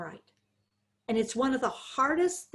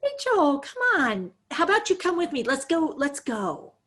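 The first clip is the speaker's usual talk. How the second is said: put-on high, louder coaxing, as if to a toddler; patronizing coaxing; 5 semitones higher, 3 dB louder than usual. Shown as a demonstration. Caregiver coaxing Rachel away in a patronizing high voice. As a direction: herd her along in a sugary voice. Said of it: "people are saying patronizing, you're talking down to her".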